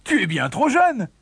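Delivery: high-pitched protest; exclaiming. A narrator's reading. Family telling the youngest he can't go, exclaiming. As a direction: object in disbelief. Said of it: "s'exclamèrent ses frères et sa mère".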